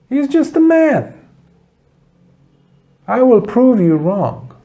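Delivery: high, mocking boast from a proud Brahmin; scornful Brahmin. Brahmin scoffing at the students, high mocking boast. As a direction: sneer it like a cocky challenger out to provoke.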